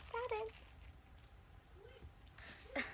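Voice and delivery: normal talking voice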